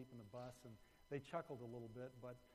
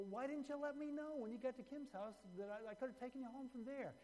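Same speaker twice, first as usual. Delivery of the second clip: upset driver; 10 semitones higher than usual. Retold or imitated. Driver scolds boy, upset.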